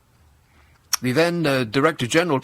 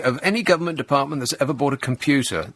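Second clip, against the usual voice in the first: ordinary talk, nothing marked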